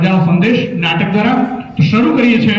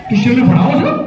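laughing repeat; 5 semitones higher than usual. Real, not acted. Repeats trick question laughing.

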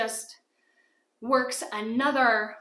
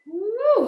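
relieved whoop; 11 semitones higher, 11 dB louder than usual. Real, not acted. Finishing a hard set.

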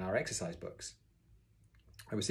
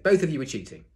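loud, raised, emphatic charge; angry accusation; 5 semitones higher, 9 dB louder than usual. A narrator's reading. Teacher accusing two boys, angry. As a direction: hit BOTH hard.

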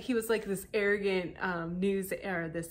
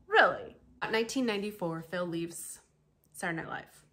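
ordinary talk, nothing marked

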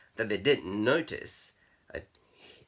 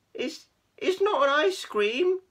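high, slow, loud squeaky cat; squeak; 8 dB louder than usual. A narrator's reading. Shy Keith denying it to laughing cats, embarrassed squeak. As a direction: squeak it out, stumbling at the start. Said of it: "he squeaked".

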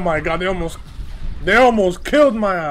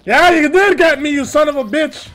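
angry shout; 7 semitones higher, 4 dB louder than usual. Real, not acted. Getting killed in game.

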